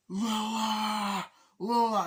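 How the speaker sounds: high, loud shout of a name; shouting Lola; calling out. Retold or imitated. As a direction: yell after her as he runs. Running, calling after her.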